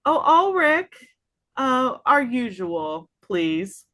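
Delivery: a gracious lady calling the dwarf by name; refined lady. Acted. Lady greets the dwarf barkeep, high and sing-song. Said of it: "she's gonna go".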